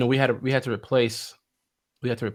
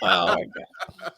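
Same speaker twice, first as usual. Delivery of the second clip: laughing disbelief; 6 semitones lower, much slower than usual. Retold or imitated.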